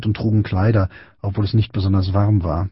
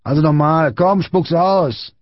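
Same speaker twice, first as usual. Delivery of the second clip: high, slow-stretched demand; bullying command; 7 semitones higher, 3 dB louder, slower than usual. A narrator's reading. Big boy demanding Friedrich repeat it, aggressive shout. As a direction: order him to spit it out.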